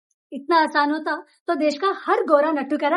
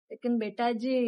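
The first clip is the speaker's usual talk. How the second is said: usual speaking voice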